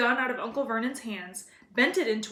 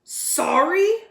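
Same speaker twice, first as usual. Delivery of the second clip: loud, high indignant bark; 7 semitones higher, 9 dB louder than usual. A narrator's reading. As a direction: bark the word back in disbelief. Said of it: "barked Hagrid".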